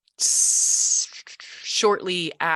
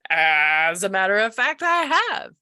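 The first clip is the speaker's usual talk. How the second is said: surprised; 3 semitones higher, 5 dB louder than usual. Retold or imitated. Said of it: "And I was like".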